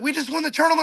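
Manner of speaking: high-pitched replay of a triumphant cry; retold excited cheer. Angler retells winning the tournament, excited high cry. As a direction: recall the win with pitch way up.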